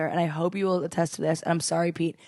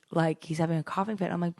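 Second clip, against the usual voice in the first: normal talking voice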